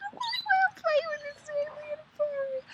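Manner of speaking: tearful high cry. Real, not acted. Moved to tears telling viewers of the calf, crying. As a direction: voice breaking into tears. Emotional retelling of buffalo story.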